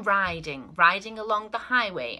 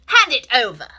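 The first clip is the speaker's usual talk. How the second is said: rat ordering; 9 dB louder than usual. Acted. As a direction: boss them around like a bandit. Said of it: "he ordered".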